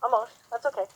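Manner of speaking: usual speaking voice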